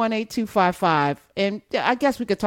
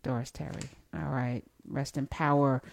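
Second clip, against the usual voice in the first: softly solemn, barely spoken; hushed tribute; 5 semitones lower, 9 dB quieter than usual. Real, not acted. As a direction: honour her name quietly. Honouring guest's late mother.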